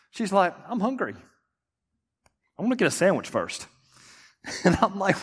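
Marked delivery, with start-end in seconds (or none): 0.7-3.7 unbothered wife. Retold or imitated. Wife calmly wants sandwich.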